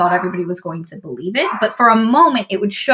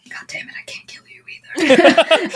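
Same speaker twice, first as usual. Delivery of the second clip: frustrated whisper; 7 semitones higher than usual. Retold or imitated. Villain frustrated in standoff.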